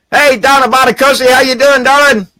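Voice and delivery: high, loud hearty greeting; cheerful call-out. Real, not acted. Greeting a viewer in the chat, a loud cheerful call-out. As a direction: sing out hello across the room.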